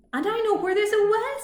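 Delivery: fast, high, loud and eager; excitedly. Acted. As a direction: blurt it out bursting with excitement. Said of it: "said the skunk excitedly".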